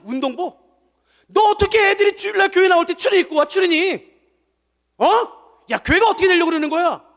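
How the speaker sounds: loud, high furious scolding; scolding elder. Retold or imitated. Elder scolding the young pastor over tracksuits, shouting. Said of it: "막 혼내시더라고요".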